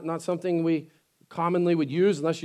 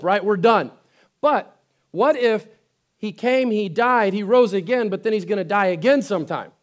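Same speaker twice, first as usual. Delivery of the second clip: usual speaking voice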